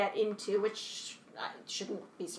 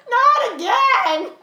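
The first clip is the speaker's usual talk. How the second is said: high, loud, slow drawn-out groan; mock groan; 11 semitones higher, 10 dB louder, much slower than usual. Dreading every line onstage with a louder drawn-out groan. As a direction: wail it louder in comic despair. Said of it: "I was like".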